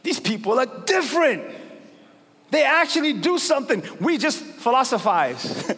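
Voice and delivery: amazed onlookers. Retold or imitated. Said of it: "people were like".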